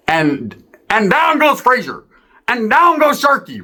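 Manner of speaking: high, slow ringside shout; boxing announcer. Retold or imitated. Storyteller calling the knockout like a boxing announcer. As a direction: call it like a fight announcer.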